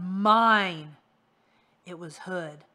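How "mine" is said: loud, hollow monster claim; voice from the ground. Acted. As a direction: growl it up from under the earth. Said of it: "said a voice out of the ground".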